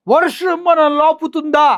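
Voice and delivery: stretched falsetto mockery of a film dialogue; mocking Pawan. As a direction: parody the star's stage roar, dragging each word.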